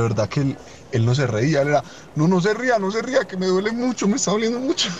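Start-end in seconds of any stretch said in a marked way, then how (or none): 2.1-4.9 high, strained whimper; pained plea. Retold or imitated. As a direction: beg high as if it hurts. Friend in pain begging.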